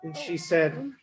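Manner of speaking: plain reading voice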